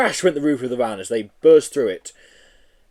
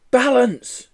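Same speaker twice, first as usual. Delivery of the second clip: urgent hiss; 12 semitones higher, 5 dB louder than usual. Acted. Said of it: "Joe hissed".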